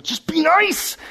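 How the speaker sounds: "Just be nice." pitched high, nice rising; exasperated. Shown as a demonstration. Pastor venting at mean people, high and exasperated. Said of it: "I just wanna tell people".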